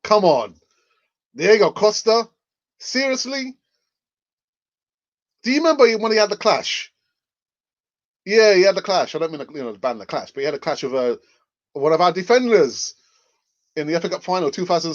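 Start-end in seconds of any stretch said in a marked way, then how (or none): 0.0-9.1 louder, higher disbelief; exasperated outburst. Real, not acted. Arsenal fan to viewers, loud exasperated disbelief. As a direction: throw your hands up at the idea.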